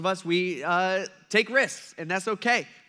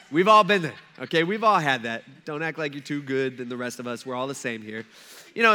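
ordinary talk

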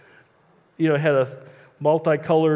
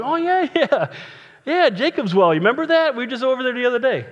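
much higher, chummy voice of an insider; mock casual local; 7 semitones higher than usual. Pastor mocking John's readers, high chummy insider voice. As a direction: play the knowing old-timer, breezy and fast at the end. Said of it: "John's writing kinda like".

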